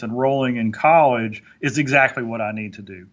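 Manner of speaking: ordinary talk, nothing marked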